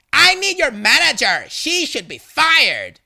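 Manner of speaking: high, loud indignant complaint; angry demand. A narrator's reading. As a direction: demand it loudly, dragging the final words. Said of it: "Angry lady".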